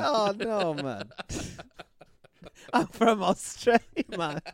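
laughing Jamaican parody of an Australian; mock Jamaican accent. Friend mocking his claim to be Australian, mock Jamaican accent. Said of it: "That's Jamaican".

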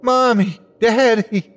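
high, emotional calling; tearful joy. A narrator's reading. Boy calls to his parents, high and tearfully happy. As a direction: call out high, holding back tears. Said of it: "I tried to hold back tears".

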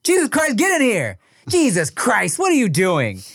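put-on hollering at a kid to come inside; parent yelling. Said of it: "his parents would always be like".

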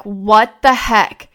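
slow, drawn-out what the heck; replaying her own stunned reaction, slowed; shocked. Seeing her dad's head jerk back, a slow stunned exclamation. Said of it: "I immediately was like".

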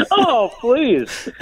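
high-pitched put-on laughing, into the words; fake audience laugh. Shown as a demonstration. Comic's friend at the back priming the audience, fake laughing. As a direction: fake a big laugh at a bad joke. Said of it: "I learned how to sound like I'm laughing".

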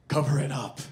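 lightly imitated director's order; a hint of a film legend giving a terse command; Clint Eastwood. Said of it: "And he goes".

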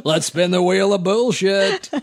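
hammed-up game show host voice; booming prize announcer, spin the wheel. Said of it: "this is my Buddhist game show".

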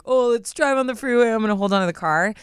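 a hint of a reckless, dim teenager; stupid teenager. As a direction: toss it off as a silly teen's bright idea. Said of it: "like a stupid teenager".

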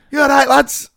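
high, loud cheery greeting; young lad. Retold or imitated. Young lad greeting the barbershop, imitated high and loud. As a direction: call out like a young lad walking in. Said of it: "this young lad comes in".

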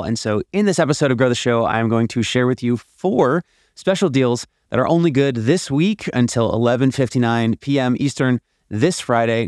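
usual speaking voice

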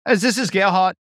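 playing a German executive's voicemail in a put-on German accent; imitating Gerhard's German accent. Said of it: "so that I could imitate their voice".

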